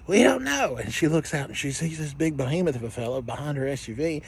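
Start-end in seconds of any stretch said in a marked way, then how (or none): 0.1-0.8 put-on high piping kids' reply; mock kids' voice. Kids answering their mom, high and piping. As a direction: squeak it out like nervous little kids. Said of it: "they're like".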